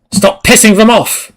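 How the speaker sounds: high, loud outburst at the company; exasperated shout. Real, not acted. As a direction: yell it at the screen in annoyance. Ranting at Adobe's pop-ups.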